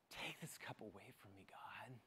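hushed, unvoiced plea to God; whispered prayer. Jesus in the garden begging God, whispered. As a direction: breathe the prayer out like a secret.

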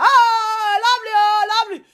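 high, loud excited calling of lovely; mock shout. Host greeting a returnee from abroad, high gushing shout. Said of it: "You just dey shout".

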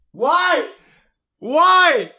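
high, loud, stretched cry; exasperated high yell; shouted disbelief. Real, not acted. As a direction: wail the question out loud.

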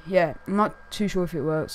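normal talking voice

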